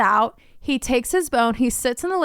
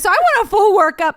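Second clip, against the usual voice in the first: insistent; 9 semitones higher, 7 dB louder than usual. Retold or imitated. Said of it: "I was a mess on the phone".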